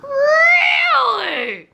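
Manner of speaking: high, drawn-out disbelief; mock incredulous. Girlfriend hears boyfriend's party excuse, mock incredulous. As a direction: stretch it out in a high, doubting squeal.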